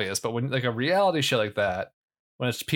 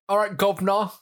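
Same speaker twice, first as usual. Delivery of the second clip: a hammed-up, higher cockney British accent, all right governor; bad British orphan accent; 9 semitones higher, 3 dB louder than usual. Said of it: "stereotypical British orphan British".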